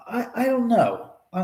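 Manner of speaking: ordinary talk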